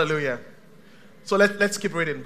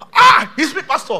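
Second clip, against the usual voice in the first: high, loud cry of recognition; mock amazed outburst; 8 semitones higher, 13 dB louder than usual. Church member acted answering the prophet, mock amazed shout. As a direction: burst out as if singled out.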